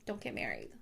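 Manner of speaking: a low, soft aside repeating the advice; low muttered repeat. Shown as a demonstration. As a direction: mutter it again under your breath.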